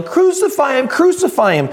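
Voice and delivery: high-pitched mock crowd chant; mob yelling. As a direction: imitate the mob calling for death. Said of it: "the crowd that were yelling".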